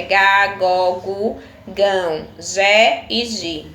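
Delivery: clear, slow classroom sounding-out; syllable drill. Shown as a demonstration. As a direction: sound out each syllable like a teacher. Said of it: "A pronúncia é".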